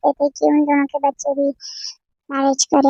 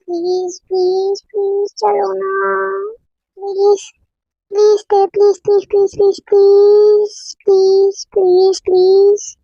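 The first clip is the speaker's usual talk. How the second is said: high, whiny begging, getting louder; begging her friend to come to the party, whining louder; pleading; 5 semitones higher, 4 dB louder, slower than usual. Acted. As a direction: beg over and over like a spoilt friend. Lisa begs Tae to come.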